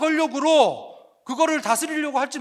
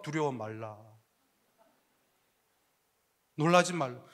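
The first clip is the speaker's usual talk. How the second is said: much lower and softer, calm and steady; gentle Jesus; 11 semitones lower, 7 dB quieter than usual. Acted. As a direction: speak as a calm Jesus reassuring disciples. Jesus voiced in a sermon. (낮고 부드럽게 다독이는 예수님 목소리)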